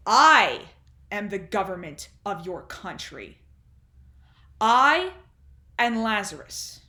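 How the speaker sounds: "I" punched out very loud, then a pause; emphatic self-assertion. Acted. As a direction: thunder each "I", then drop back for the rest. Undershaft asserts his power.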